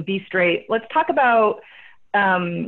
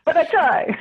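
normal talking voice

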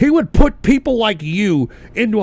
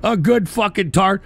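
ordinary delivery

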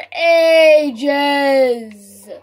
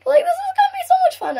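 squeaky, much higher, fast and giddy; over-the-top excitement; 13 semitones higher, faster than usual. Real, not acted. Streamer gushing to viewers about the match, excited. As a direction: let the joy run away with you. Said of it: "I'm talking so weird".

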